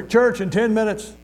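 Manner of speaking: high, drawn-out yard call; high-pitched calling out, not truly shouted; imitated holler.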